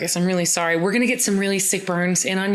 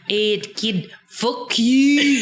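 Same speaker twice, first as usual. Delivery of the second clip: sneering redcoat; 3 semitones higher, much slower than usual. Retold or imitated. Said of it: "he's like".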